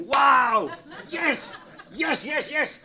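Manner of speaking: high, loud joyful yells; excited cheering. Real, not acted. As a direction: cheer like you just got great news. Cheering over friend's remark. (uitgelaten juichen, hoog)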